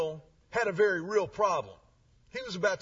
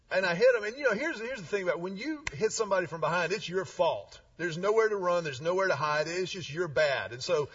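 usual speaking voice

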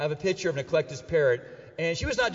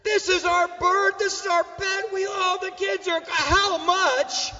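high, loud mock indignation; shocked outrage; 13 semitones higher, 4 dB louder, slower than usual. Husband appalled at wife pricing the family bird, shocked high protest. Indignant reaction to wife's question.

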